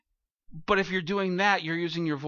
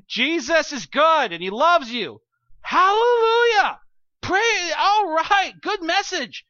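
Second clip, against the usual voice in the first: much louder and higher, slow hammed-up preaching; mock preacher; 10 semitones higher, 9 dB louder, slower than usual. New teacher at the music stand, hammed-up preaching. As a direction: ham up a novice sermon with a big hallelujah. Parody of nervous novice preaching.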